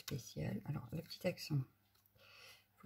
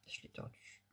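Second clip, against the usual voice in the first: whisper; 4 dB quieter than usual. Real, not acted. Crafting aside, whispered.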